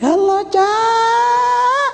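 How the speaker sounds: very high, very slow wailing cry; wailing widow. Retold or imitated. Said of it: "dia nangis". (ratapan istri yang menangis, tinggi dan pelan)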